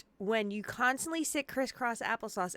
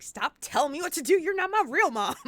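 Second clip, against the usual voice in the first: higher, hammed-up childish retort; mock bratty defiance; 6 semitones higher, 4 dB louder than usual. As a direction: play a petulant kid talking back. Bratty retort to doctor, then laughs.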